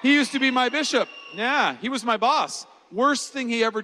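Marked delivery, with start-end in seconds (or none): none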